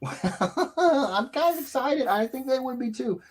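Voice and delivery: high, slow excited voice; high giddy excitement. Real, not acted. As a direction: let the pitch jump up with glee. Squealing over announcement.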